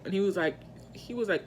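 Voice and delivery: ordinary delivery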